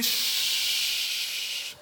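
hushed shh before a prophecy; a mock prophetic hush. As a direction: shush the room like a prophet. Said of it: "I always like to prophesy".

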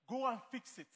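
normal talking voice

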